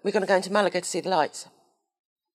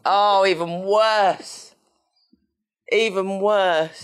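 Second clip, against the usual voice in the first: mock outrage; 3 semitones higher, 3 dB louder, much slower than usual. Mock horror at popcorn.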